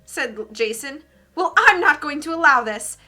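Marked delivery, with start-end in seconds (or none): 1.4-3.0 stern teacher. Acted. Teacher objecting in story.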